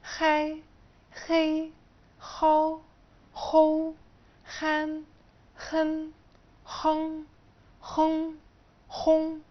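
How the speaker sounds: each syllable high and clear with a light خ; pronunciation demo of a light kh; soft kh drill. Shown as a demonstration. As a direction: say خاي and خو with a softened kh, syllable by syllable. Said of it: "الخاء المخففة".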